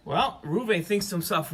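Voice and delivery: ordinary delivery, nothing marked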